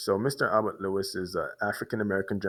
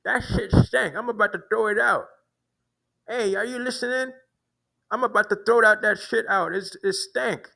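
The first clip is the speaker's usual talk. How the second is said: high-pitched mimicry, a bit louder; mocking the man's complaint to the guard, high voice; imitating the man; 11 semitones higher, 3 dB louder than usual. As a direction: throw his words out in a raised, high voice. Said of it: "that's how they talk".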